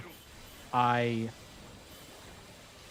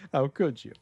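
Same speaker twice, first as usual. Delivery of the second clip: higher, louder mock indignation; mock outrage; 4 semitones higher, 3 dB louder than usual. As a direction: scold like a shocked purist. Mock outrage at summon use.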